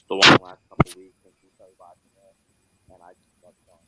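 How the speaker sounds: muffled and far off, hand over the mic; talking from inside a fishbowl. Real, not acted. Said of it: "You sound like you're in a fishbowl".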